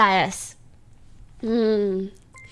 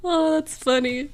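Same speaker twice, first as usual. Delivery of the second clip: ordinary delivery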